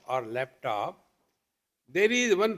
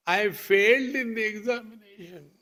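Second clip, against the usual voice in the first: put-on crying child; 4 semitones higher than usual. Child confessing a bad dream to his father, mock wail. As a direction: wail it like an upset schoolchild. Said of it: "who got up in the morning and started crying".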